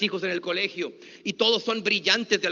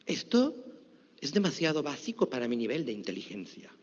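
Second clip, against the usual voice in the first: 1 snob; 8 semitones lower, 7 dB quieter than usual. Retold or imitated. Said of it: "aquella persona que dijo".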